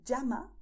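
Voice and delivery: "llama" said "jama", with English j; ll as in jeans. Shown as a demonstration. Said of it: "with the same sound that you have in English when you say jeans".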